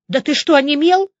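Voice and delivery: impatient. A narrator's reading. Woman pressing the nodding major, impatient. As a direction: prod the silent man into speaking. Impatient prod at silent friend.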